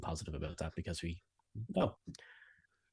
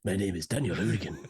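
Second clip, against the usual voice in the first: louder, put-on Spanish accent; mock Spanish accent; 6 dB louder than usual. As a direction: introduce yourself like a cartoon Spaniard.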